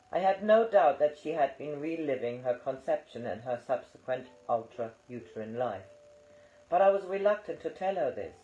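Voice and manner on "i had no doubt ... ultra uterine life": ordinary talk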